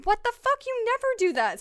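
high, loud angry outburst; pissed yell. Retold or imitated. Scolding the cat for getting into the toy box, angry high yell. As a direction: snap at the cat, fed up. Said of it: "So I was, like, pissed".

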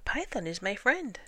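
high protesting iguana voice; earnest lizard protest; iguana's cry. A narrator's reading. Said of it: "cried the iguana".

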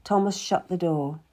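plain reading voice

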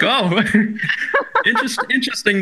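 laughing, high interesting; giggly surprised oh; amused. Retold or imitated. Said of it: "I was like".

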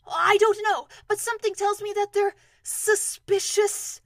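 high, loud, urgent warning; strained, carrying voice; calling down. Acted. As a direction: call it down to a friend below. Pooh calls down from balloon.

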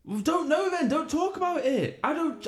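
high, exasperated retorts; getting mad. Real, not acted. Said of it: "You're getting a bit mad now".

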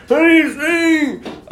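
put-on high, slow creaky old voice; mock old-timer; old person voice. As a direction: speak like a frail elderly grandkid. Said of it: "sound like the old people".

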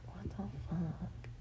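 breathy whispered disbelief; whispered what the fuck. Retold or imitated. Stuck at her desk all day, a whispered what the fuck. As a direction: mutter it under your breath in disbelief. Bored at work, inner reaction.

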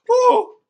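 very high, loud joyful woo; excited whoop. Real, not acted. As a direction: let out a happy yell. Excited outburst after retelling.

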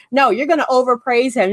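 ordinary talk

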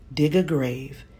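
plain reading voice

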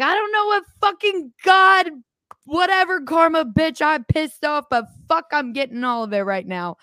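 mock furious rant in the kitchen; exasperated yell. Retold outburst in kitchen.

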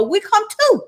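high, eager tagalong voices; eager disciples. Acted. Disciples begging Peter to take them fishing, high and eager. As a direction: chime in like eager kids begging to come. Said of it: "they all said".

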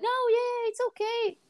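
very high, sing-song soothing; imitated reassurance. Mom placating upset daughter, high sweet mock reassurance. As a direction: mimic a mom suddenly turning comforting. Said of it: "a voice to calm you down".

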